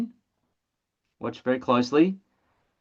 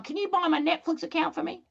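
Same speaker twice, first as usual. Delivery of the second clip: a much higher, pleading doorstep voice; mock Reed Hastings; 13 semitones higher, 6 dB quieter than usual. Netflix founder knocking on a door: mock pleading high voice. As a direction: beg like a hapless door-to-door seller. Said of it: "Reed Hastings knocking on your door going".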